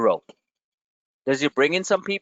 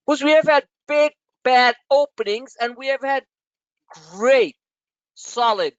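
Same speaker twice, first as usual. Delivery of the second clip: louder, pressing each have; emphatic louder point; 8 semitones higher, 5 dB louder than usual. Real, not acted. Trader insisting to viewers, louder and emphatic. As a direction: hammer home that both days happened.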